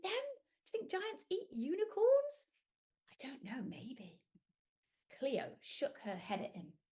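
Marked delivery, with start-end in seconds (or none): 3.1-4.2 soft whisper; hushed suspense. Acted. Storyteller teasing kids about the giant, whispering.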